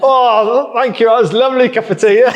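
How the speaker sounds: high, loud, laughing fake thanks; mock grateful. Tradesman thanks the lady for awful tea, mock grateful. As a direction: gush your thanks while laughing. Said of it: "and we both went".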